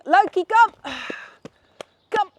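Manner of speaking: high, loud call across the path; calling the dog. Real, not acted. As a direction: call the dog back sharply. Calling dog away from cyclist.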